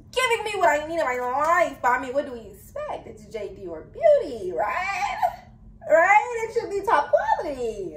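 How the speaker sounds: high, swooping, delighted boast; excited hyping. Real, not acted. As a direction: hype it up in a high, exclaiming voice. Excited hyping of her product.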